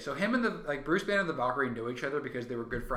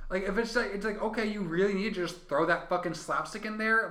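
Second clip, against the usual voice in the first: ordinary delivery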